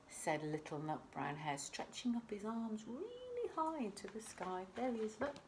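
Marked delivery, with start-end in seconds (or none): none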